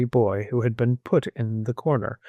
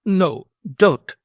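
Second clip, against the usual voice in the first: high, loud desperate plea; imploring; 8 semitones higher, 6 dB louder than usual. A narrator's reading. Old squire implores the girl not to go, imploring. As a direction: beg her to stay. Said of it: "he implored".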